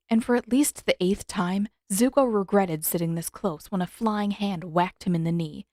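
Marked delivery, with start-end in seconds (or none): none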